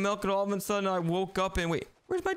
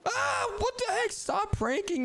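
startled scream. Acted. Told Grimace is behind him.

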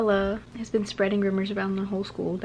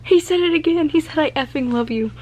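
loud, high squealed report; thrilled outburst; 6 semitones higher, 5 dB louder than usual. Real, not acted. Reader tells viewers he confessed, thrilled. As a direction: blurt it out, swooning.

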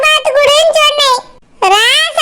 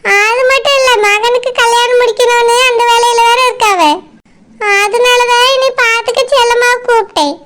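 feeble excuse; 4 semitones lower than usual. Acted. Sister-in-law making excuses, feigning illness.

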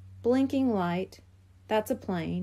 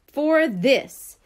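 louder, emphatic reveal; declaring; 3 semitones higher, 8 dB louder than usual. A narrator's reading. Buster holding up his photographs, declaring proudly. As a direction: announce it with a flourish. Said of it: "he declared".

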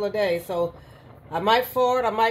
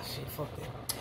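breathy whispered swearing; whispered curse; 8 semitones lower, 15 dB quieter, slower than usual. Real, not acted. Gives up, muttering under breath.

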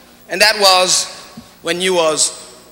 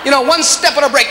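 usual speaking voice

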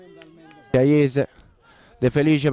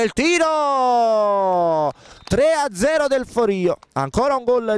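long drawn-out high goal shout; breathless italian goal commentary; 6 semitones higher, slower than usual. Real, not acted. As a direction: stretch the shot call, then erupt. Third goal, commentator erupts.

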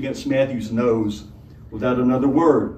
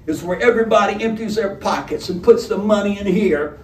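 lightly voiced menacing robber; a hint of a gruff robber, easy threat; 7 semitones higher than usual.

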